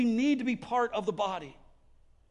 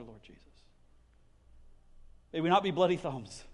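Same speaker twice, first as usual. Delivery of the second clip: closing prayer; 3 semitones lower than usual. Acted. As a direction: pray it for the congregation, sincere and steady. Pastor leads closing prayer.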